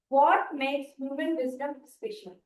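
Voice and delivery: usual speaking voice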